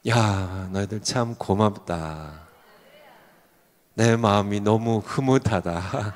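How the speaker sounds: low, soft, slow words of approval; gentle hint of a fatherly God, hushed and low; lightly voiced God. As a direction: speak softly and slowly as a pleased God. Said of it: "하나님께서 여러분들 보실 때마다".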